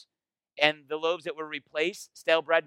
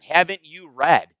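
pointed rebuke; 8 dB louder than usual. Retold or imitated. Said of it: "So to say to them".

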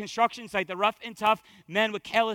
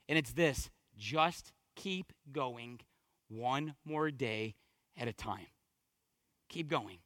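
low, soft, measured charge; hushed sermon close; 4 semitones lower, 6 dB quieter than usual. Real, not acted. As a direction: gently urge the congregation onward, with long pauses.

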